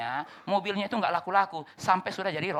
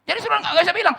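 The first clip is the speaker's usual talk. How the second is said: full-force preaching; 10 dB louder, faster than usual. Acted. Preaching burst.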